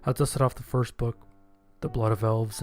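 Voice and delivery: ordinary delivery, nothing marked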